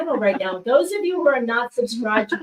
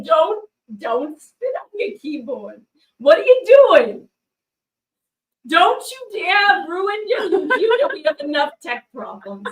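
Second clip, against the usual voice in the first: laughing scold; 5 semitones higher than usual. Real, not acted. Scolding Rachel for spitting.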